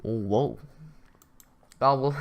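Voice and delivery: usual speaking voice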